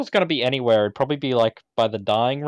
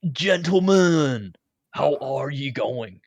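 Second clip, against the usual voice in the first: slow formal greeting; much slower than usual. Acted. Character greets the team.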